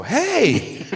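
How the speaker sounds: sudden high shout of greeting; a stranger calling out brightly, hey; passerby's cheery call. Retold or imitated. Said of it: "they go".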